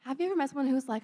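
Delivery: ordinary delivery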